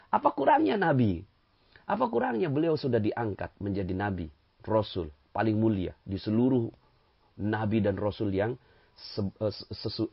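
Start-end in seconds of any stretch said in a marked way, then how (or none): none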